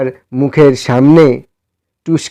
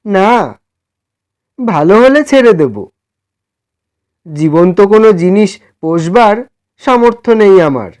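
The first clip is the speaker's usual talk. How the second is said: raised, pleading voice of a humble poor man; timid poor man; 6 semitones higher than usual. Acted. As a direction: ask shyly for a favour, a bit higher. Same humble man explains. (বিনীত গরিব মানুষের কুণ্ঠিত অনুরোধ)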